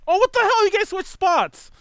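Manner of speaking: loud, startled, stretched-out disbelief; shocked at the screen; surprised outburst. Real, not acted. Notices co-hosts swapped places.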